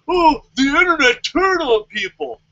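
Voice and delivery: mock voice of whining fans. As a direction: sneer at the fans' line. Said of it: "are like".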